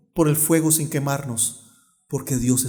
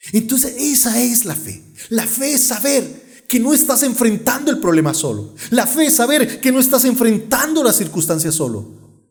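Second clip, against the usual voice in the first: higher-pitched, emphatic preaching; fervent preaching; 8 semitones higher, 3 dB louder than usual. Real, not acted. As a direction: drive the point home to the congregation. Preaches with fervour. (predicación enfática y ferviente)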